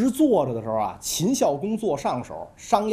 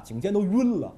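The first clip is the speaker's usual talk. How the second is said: plain reading voice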